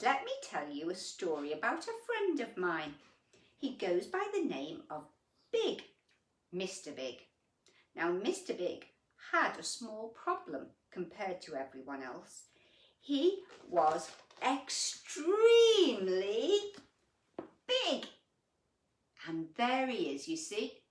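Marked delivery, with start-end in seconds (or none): 0.0-7.3 storybook voice for little ones; gentle picture-book reading; reading to children. A narrator's reading. Reads picture book aloud. 8.0-12.5 storybook voice for little ones; gentle picture-book reading; reading to children. A narrator's reading. Reads picture book aloud. 13.0-18.1 "extremely" stretched slow, high and loud, then "big." high; stress on extremely. A narrator's reading. Storyteller stressing size.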